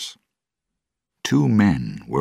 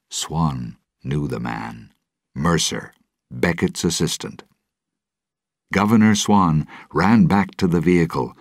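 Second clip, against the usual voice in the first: ordinary delivery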